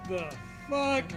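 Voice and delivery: ordinary delivery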